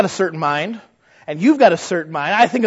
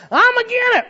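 much higher, louder contrary put-on voice; Brother I'm Agin It; 5 dB louder than usual. Church naysayer rejecting every plan, high and loud. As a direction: object to everything in a high stubborn squawk. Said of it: "instead of".

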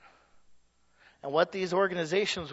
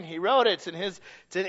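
ordinary talk

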